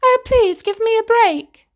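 high, loud frightened plea; pleading cry. A narrator's reading. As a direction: beg for your life, high and shrill. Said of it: "She cried".

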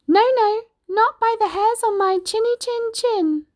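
high-pitched piggy squeal of refusal; little pig, defiant. Acted. Little pig refusing the wolf at the door, high squeaky defiance.

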